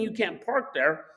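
ordinary delivery, nothing marked

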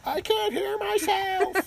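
high, slow whining complaint; whiny musician voice. Retold or imitated. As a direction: whine it like a needy player. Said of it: "What's the thing you hear the most?".

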